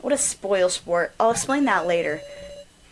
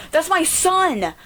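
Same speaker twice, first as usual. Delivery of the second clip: laughing mockery; 6 semitones higher, 3 dB louder, much slower than usual. Mocking repeat with laugh.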